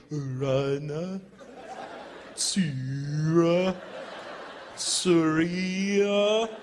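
rising Korean-style counting, each number apart; dry cleaner counting. Retold or imitated. Dry cleaner counting shirts to six in Korean, measured.

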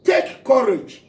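loud, slow call of take courage; commanding call. Acted. Jesus calling to his terrified disciples, slow loud command. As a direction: call out to steady frightened men. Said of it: "Jesus immediately said to them".

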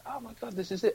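high-pitched retold thrill; excited realisation. Impressionist recalling a breakthrough, excited. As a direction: pitch up with sudden discovery.

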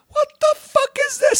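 high, slow, stunned cry; shocked outburst. Retold or imitated. Seeing the gun hole in the drywall, a stunned high cry. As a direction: blurt it out in total disbelief.

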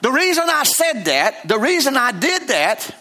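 tattling on his wife, half-joking plea; mock complaint to God. Husband telling on his wife to God, mock whine. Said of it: "I have even told on her to God".

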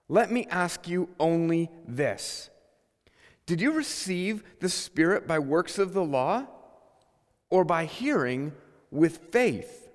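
scripture reading. Acted. Reads the epistle aloud.

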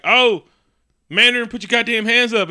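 high, loud mock command; acted shouted order. Tony confronting the Mandarin in a retelling, mock shouting. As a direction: shout it as a hammed-up arrest.

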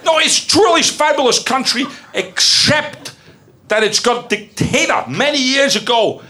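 high, put-on Dutch accent. Performer describing a country for guessers, in a Dutch accent. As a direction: ham up a dubious Dutch accent. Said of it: "if you knew that was Dutch".

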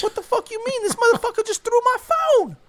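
very high, loud outraged whine; mock outraged squeal. Friend two squealing outrage at friend one, very high. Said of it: "And they're like".

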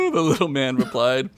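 ordinary delivery

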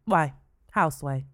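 ordinary delivery

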